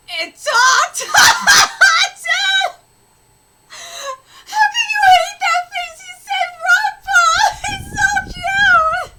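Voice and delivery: high, very loud laughing shriek; ecstatic amazement. Acted. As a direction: squeal in disbelief at a talking baby. Amazed the baby talked.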